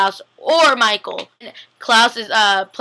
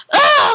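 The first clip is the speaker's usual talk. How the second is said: put-on very high, loud kid's shriek; imitated five-year-old's frustrated squeal; mock tantrum scream; 5 dB louder than usual. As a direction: shriek like a child denied a toy. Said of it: "don't get their toys and they're like".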